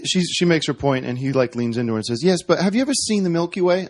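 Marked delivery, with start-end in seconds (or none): 2.2-3.9 higher-pitched impression of a comedian; Russell Brand. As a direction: lightly imitate a comedian leaning in with a question. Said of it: "my Br-, Russell Brand impression".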